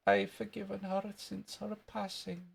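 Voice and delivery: high Scottish schoolboy voice; little Scottish kid, piping; Jimmy Krankie. Acted. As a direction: put on a high Scottish boy's voice. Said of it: "That was wee Jimmy Cranky".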